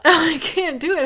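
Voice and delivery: cracking up mid-attempt; can't stop laughing. Real, not acted. Breaks down laughing.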